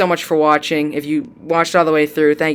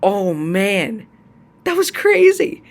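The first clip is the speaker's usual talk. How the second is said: excited squeal; 5 semitones higher, much slower than usual. Real, not acted. Reacting to the long-awaited kiss.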